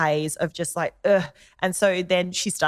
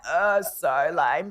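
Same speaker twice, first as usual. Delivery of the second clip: very slow, drawn-out mock scoff; bored scoff; much slower than usual. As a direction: stretch it out, teen-style eye-roll sound. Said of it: "And I'm like".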